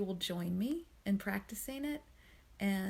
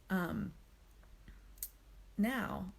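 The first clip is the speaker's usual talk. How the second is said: ordinary delivery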